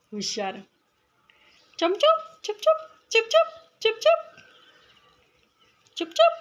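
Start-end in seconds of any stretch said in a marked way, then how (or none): 1.8-6.3 very high chirps, a little louder; chirping bird call. Acted. Coaxing the sparrow onto her shoulder with high chirps. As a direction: chirp out chum-chum, chup-chup like a bird. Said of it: "आणि चिपचिप असा आवाज काढला".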